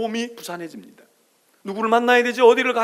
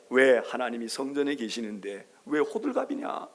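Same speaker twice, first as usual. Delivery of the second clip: normal talking voice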